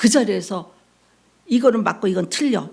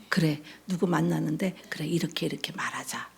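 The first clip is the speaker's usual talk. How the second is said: self-talk whisper; 3 semitones lower, 8 dB quieter than usual. Retold or imitated. Muttering plans to herself.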